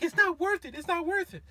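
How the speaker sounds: high woman's voice, pleading; girlfriend calming him. Retold or imitated. Girlfriend telling boyfriend to let it go, high calm plea. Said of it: "she's like".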